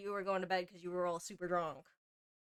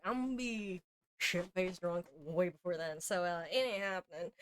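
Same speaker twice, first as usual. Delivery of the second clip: normal talking voice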